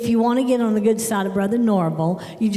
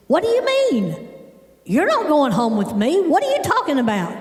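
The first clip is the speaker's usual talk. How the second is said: hammed-up high-pitched bafflement; indignant mock alarm; shocked disbelief; 5 semitones higher than usual. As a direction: imitate an incredulous dad, voice shooting high. Father's retold shocked phone reply.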